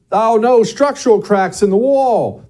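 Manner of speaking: loud, high-pitched mock alarm; mock dismay. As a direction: play up a homeowner's dismay. Mock dismay at home's flaws.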